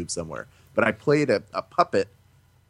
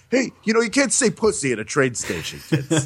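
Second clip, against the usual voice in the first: mock Carlin; 7 semitones higher than usual. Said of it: "to go, like".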